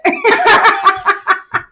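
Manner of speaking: high, helpless giggling; high giggle fit. Real, not acted. Teacher giggling at her failed Cockney Sheila, high giggle. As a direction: giggle at your own bad accent.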